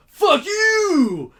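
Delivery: a hammed-up outraged cry; furious shout. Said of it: "He's like".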